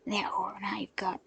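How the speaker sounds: breathy, scornful brute; thug's snarl. Acted. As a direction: cut off mid-threat. Thug continues snarling.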